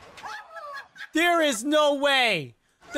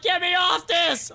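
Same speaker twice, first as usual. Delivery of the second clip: mock panicked yell; 6 semitones higher than usual. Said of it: "I'd be like".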